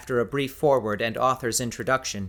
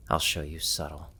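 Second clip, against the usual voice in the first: low playful threat; 6 semitones lower, 5 dB quieter than usual. Acted. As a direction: lower and softer, a teasing promise. Husband's playful threat to wife.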